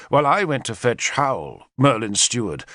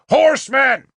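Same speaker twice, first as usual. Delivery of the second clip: high, loud repeated alarm; shouted warning; 12 semitones higher, 6 dB louder than usual. A narrator's reading. Guard shouting a warning from the wall, loud. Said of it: "the guard cried".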